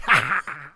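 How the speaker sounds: loud gloating ha ha; mock triumphant laugh. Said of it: "You're like".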